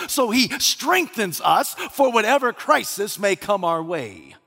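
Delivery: high, rousing pulpit delivery; forceful preaching. Real, not acted. Preacher assures congregation God strengthens them, impassioned and emphatic. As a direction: drive it home with rising conviction.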